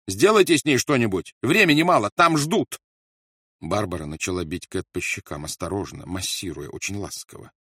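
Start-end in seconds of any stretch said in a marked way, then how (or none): 0.1-2.8 loud, high impatient command; urgent demand. A narrator's reading. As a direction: hurry her, voice strained. Rolf urges Barbara frantically. (громкий нетерпеливый приказ)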